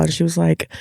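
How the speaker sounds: ordinary talk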